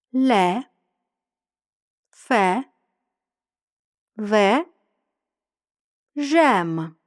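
"Ле, ме, се, пе, ле, фе, ве, жем" one at a time, vowel wide open; model open E. Shown as a demonstration. Teacher says words for learners to repeat, wide open E. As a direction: hold the open E in each of "Ле, ме, се, пе, ле, фе, ве, жем". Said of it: "Я кажу, ви повторюєте".

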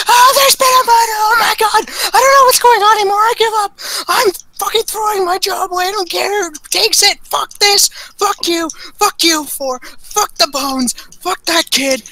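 frantic screaming. Acted. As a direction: scream like a man whose career just snapped. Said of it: "Vimes rushes in and screaming".

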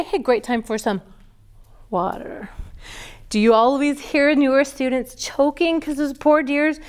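normal talking voice

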